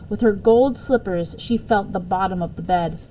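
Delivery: plain reading voice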